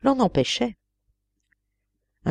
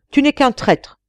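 accusing; 8 semitones higher, 5 dB louder than usual. A narrator's reading. Fisherman rebuffs the pleading genie.

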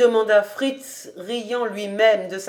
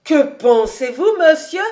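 put-on louder, higher old housekeeper protesting; shocked exclamation; 6 semitones higher, 7 dB louder than usual. Old housekeeper objecting to her master's coat, shocked cry. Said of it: "s'écria la vieille".

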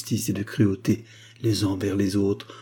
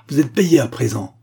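loud, raised, cutting; angry dismissal; 4 semitones higher, 6 dB louder than usual. A narrator's reading. Abogin paying off the doctor, angrily dismissive. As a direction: finish him off with disdain.

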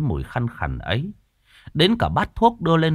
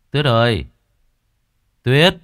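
calling out; 6 dB louder than usual. A narrator's reading. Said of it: "Gã cất tiếng gọi vợ".

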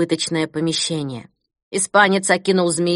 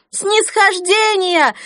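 repeated even higher, slow loud cry; crowd shout; 13 semitones higher, 4 dB louder, much slower than usual. Acted. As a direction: echo the chant, higher still. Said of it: "зал взорвался от женских криков". (повторный выкрик толпы)

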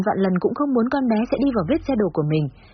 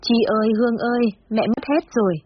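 hammed-up tearful cry of loss; mock wailing; 4 semitones higher than usual. A narrator's reading. Mother wails to her daughters after losing her money, hammed-up tearful cry. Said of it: "mẹ khóc mếu bảo".

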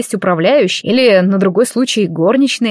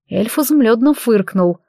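plain reading voice

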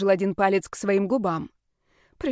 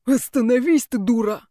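higher, louder angry roar; furious shout; 4 semitones higher, 5 dB louder than usual. Acted. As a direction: bellow it, losing control. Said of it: "почти грозным рыком разнёсся". (яростный крик)

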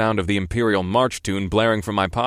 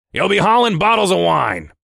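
high, loud, gloating bark; irritated and gleeful; 10 semitones higher, 6 dB louder than usual. A narrator's reading. Boss springs the bad load on his driver, irritated glee. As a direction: crow it with irritation and glee mixed. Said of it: "equal parts irritated and gleeful".

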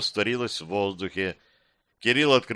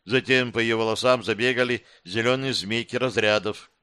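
ordinary delivery

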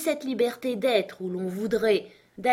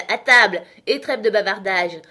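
louder, calling everyone to the table; 8 dB louder than usual. A narrator's reading. Arthur summons the chattering guests, loud call. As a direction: cut the chatter, come eat. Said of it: "s'écria-t-il".

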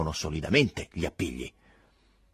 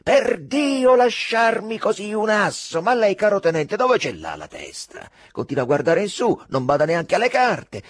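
loud, high scolding shout; the captain rages over a wasted ace; angry shouting; 12 semitones higher, 8 dB louder than usual. A narrator's reading. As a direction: berate the lieutenant at full voice. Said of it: "gridò, letteralmente urlò il capitano Monti".

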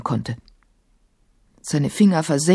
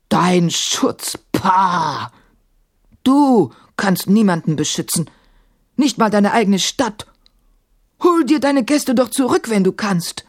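scornful djinn; 4 semitones higher than usual. A narrator's reading. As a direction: sneer at the helpless caliph. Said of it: "rief Sahim".

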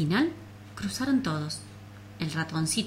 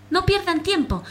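much higher, loud urgent call; shouting; 11 semitones higher, 5 dB louder than usual. Acted. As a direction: yell an urgent order. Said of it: "gritó el ratoncito".

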